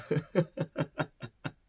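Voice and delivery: high hammed-up laugh; mock laughing. Mom laughing in shock at the airport. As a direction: cackle like you've lost it. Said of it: "she starts laughing like".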